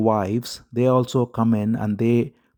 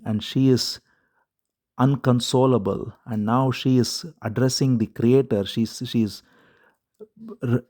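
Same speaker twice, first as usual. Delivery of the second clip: ordinary talk, nothing marked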